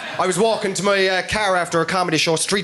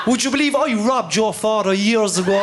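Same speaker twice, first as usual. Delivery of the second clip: robber voice; 7 semitones higher than usual. Retold or imitated. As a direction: pitch it up and laugh through it.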